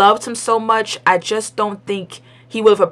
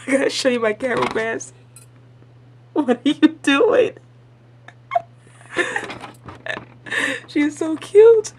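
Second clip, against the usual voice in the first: cooing aside; 6 semitones higher than usual. Real, not acted. Talking to grandma off camera.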